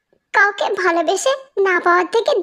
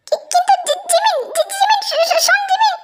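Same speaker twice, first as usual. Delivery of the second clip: stammering; 10 semitones higher than usual. Acted. Tae stammering after Jimin leaves.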